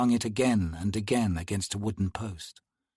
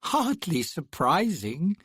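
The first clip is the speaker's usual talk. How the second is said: slow, bored drawl, higher; 5 semitones higher, slower than usual. Acted. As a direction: stretch the words out lazily. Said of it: "the woman drawled".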